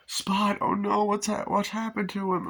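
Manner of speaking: high mock-worried kid voice; worried child. Boy asking after his dog Spot, high mock-worried voice. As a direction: imitate a boy fretting over his pet.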